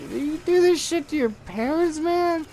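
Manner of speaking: very high, squeaky disbelief; mock incredulous. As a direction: question him in a comically high voice. Acted incredulous reply to the kid.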